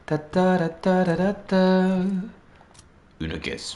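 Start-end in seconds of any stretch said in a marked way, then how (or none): 0.1-1.9 high, slow ta ta ta bursts; gunfire imitation. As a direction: rattle it off like a machine gun.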